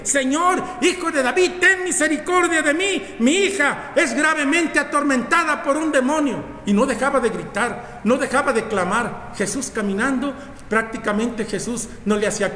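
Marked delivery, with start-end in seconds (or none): 0.0-6.4 crying out. Acted. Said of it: "iba gritando prácticamente".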